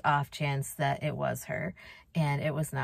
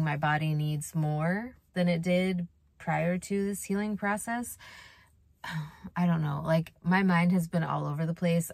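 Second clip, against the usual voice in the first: ordinary talk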